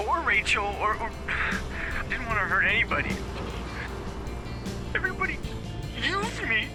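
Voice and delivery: halting, distressed voicemail confession; frightened, remorseful last message; tearful apology. Acted. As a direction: apologize brokenly, with long pauses and swings in pitch.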